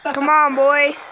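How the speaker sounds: loud rallying call to the team; shouting orders over battle. Real, not acted.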